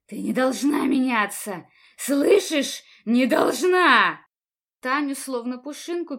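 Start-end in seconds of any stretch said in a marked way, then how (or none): 0.1-1.7 loud, raging cry; furious shout. A narrator's reading. Attacker shouts at victim. 2.0-4.2 screaming, slow and loud; furious shout. A narrator's reading. Attacker shouts at victim.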